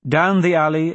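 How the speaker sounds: usual speaking voice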